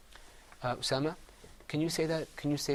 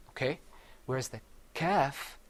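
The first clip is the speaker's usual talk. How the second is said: usual speaking voice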